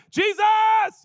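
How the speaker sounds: very high, loud comic outburst; exclaimed cry. Acted. Preacher jokingly crying out the name, loud outburst. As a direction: cry out the name, soaring in pitch. Mock exclamation after "in vain.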